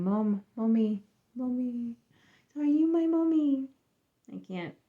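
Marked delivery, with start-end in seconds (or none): 0.0-3.7 attempted British child accent, rising high on the last question; a lightly done British little boy asking for his mom; bad kid British accent. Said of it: "speak in a very good kid British accent".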